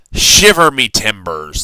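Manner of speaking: loud, slow pirate exclamation. A narrator's reading. Pirate captain startled at the beach, loud pirate voice. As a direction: growl it out like an old sea dog. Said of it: "the pirate said".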